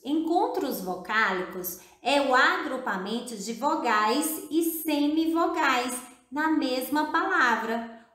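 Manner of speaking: usual speaking voice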